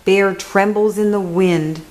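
ordinary delivery, nothing marked

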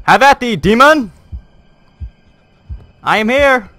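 high, loud mock-heroic battle cry; bold swashbuckling bravado. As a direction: declaim like a gallant knight.

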